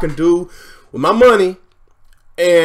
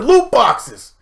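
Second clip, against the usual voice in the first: usual speaking voice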